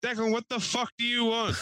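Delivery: high-pitched cranky holler; mad as hell. Retold or imitated. Old man yelling back at the young neighbor, mad as hell. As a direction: imitate an irate old neighbor. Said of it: "Like, mad as hell".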